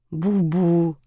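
plain reading voice

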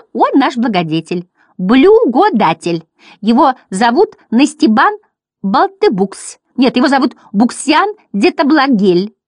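high, loud clownish jabber; shouting. A narrator's reading. Butterflies passing it on, excited high shouting. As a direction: garble it grandly, high and loud. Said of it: "крича в ухо соседу".

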